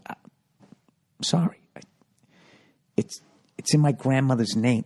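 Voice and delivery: halting, meek movie impression; Johnny Roast Beef. Johnny excusing himself to Jimmy, meek halting apology. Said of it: "thinking about Johnny Roast Beef".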